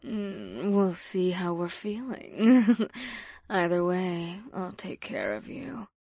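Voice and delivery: usual speaking voice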